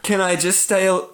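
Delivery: fighting not to cry as you ask; holding back tears. Real, not acted. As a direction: push the quote out while really choking up. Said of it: "still makes me fucking cry".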